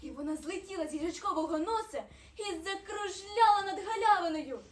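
plain reading voice

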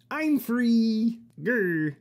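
ordinary delivery

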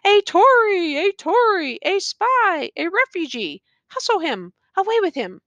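high, accusing crowd cries; general shout. A narrator's reading. Bystanders denounce Rip as a spy, shouting. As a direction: call it out like an angry mob. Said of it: "a general shout burst from the bystanders".

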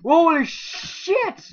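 slow, very high, loud drawn-out exclaim; amazed shout. Real, not acted. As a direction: gasp it out in awe. Sees something in game.

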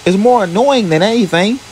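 high, scornful jab. A narrator's reading. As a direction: dismiss him with scorn. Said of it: "scoffed Sarah".

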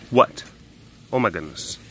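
usual speaking voice

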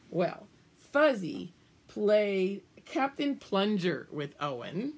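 plain reading voice

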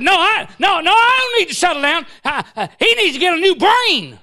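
loud, high indignant protest; hot-headed hollering; railing. Retold or imitated. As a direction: rant back, stammering with outrage. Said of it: "Man, I'm, I'm railing".

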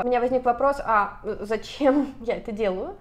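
normal talking voice

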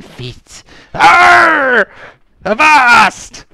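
loud, high, raving pirate shrieks; screaming madwoman with piratical "arr"; unhinged pirate woman. Acted. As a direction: rant like a crazed castaway pirate. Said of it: "she's clearly a little bit unhinged".

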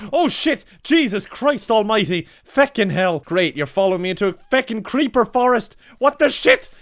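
high, loud panicked yelling; panicked shouting. Real, not acted. Streamer ambushed in a creeper forest, yelling in panic. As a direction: scream at the mobs to get away.

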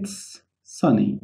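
it's sunny said very slowly and softly; slow teacher demo; slow model phrase. Shown as a demonstration. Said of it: "هنا بنقول إيه؟".

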